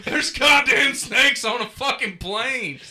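loud, high, slow laughing rant. Real, not acted. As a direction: laugh through the outrage. Laughing rant about snakes.

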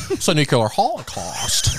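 slow, cheery film star impression; Jimmy Stewart. Comedian's bit greeting disaster positively, slow film star impression. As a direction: draw it out as if delighted. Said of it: "Jimmy Stewart says sounds super positive".

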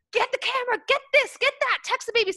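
high, frantic mock orders; bossy bickering. Friend ordering the other before a Zoom call, frantic bossy yell. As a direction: boss your sibling around in a shrill panic. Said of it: "siblings who fight all the time".

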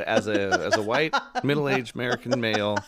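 loud, laughing mock confession; laughing apology. Real, not acted. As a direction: laugh through the apology, loudly. Laughing self-mocking apology.

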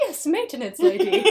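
giggling reply; laughing quip; laughing mock reply. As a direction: answer with barely held laughter. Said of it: "She's like".

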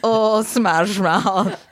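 very slow mock Scottish; Shrek. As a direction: savour it in a drawn-out Scottish accent. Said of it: "the star of Shrek".

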